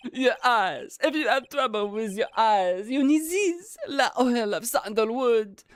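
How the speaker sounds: put-on high French-accented chef voice. French chef selling oil.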